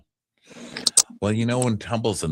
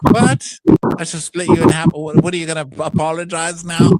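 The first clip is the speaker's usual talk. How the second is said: louder, higher indignant retort; put-on scolding, pitched up; mock outrage; 8 semitones higher, 4 dB louder than usual. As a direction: imitate an angry threat. Acting out angry reply to apology.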